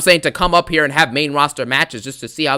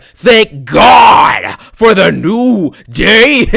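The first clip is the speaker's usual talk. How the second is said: imitating Xavier's catchphrase; 6 semitones higher, 9 dB louder, much slower than usual. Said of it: "he's like".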